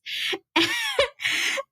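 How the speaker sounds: high bright burst of laughter; real high laugh. Real, not acted. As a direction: laugh at yourself. Laughs at own story.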